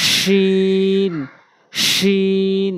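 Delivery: শিন with a long sh sound; শিন pronounced slowly with long sh; drawn-out sheen. Shown as a demonstration. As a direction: stretch শিন slowly, tongue middle to the palate. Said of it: "জিহ্বার মাঝখানের অংশটা উপরে তালুর সঙ্গে লাগাবেন".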